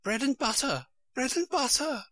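high, frightened little voice; small, high Queen muttering; whispered fretful repeating. A narrator's reading. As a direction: repeat it to yourself, helpless and high-pitched. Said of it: "repeating something in a whisper to herself".

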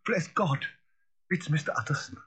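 relieved cry, high; cook crying out. A narrator's reading. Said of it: "the cook cried out".

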